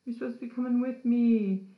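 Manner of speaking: mock indignant whine, high and loud; retold pissed-off complaint. Zach to Aaron for leaving him behind, mock pissed off. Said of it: "Zach gets all pissed off".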